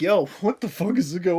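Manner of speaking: normal talking voice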